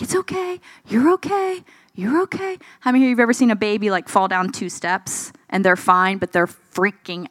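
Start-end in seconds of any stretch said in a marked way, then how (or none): 0.0-2.6 high, gentle comforting repeats; lightly voiced parent reassuring a child; soothing parent.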